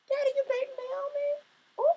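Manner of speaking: put-on high, slow child's voice, teasing; kids asking. Vladimir's kids asking their father about Naomi, high childish voice. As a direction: play a little kid quizzing dad. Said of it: "Them kids like".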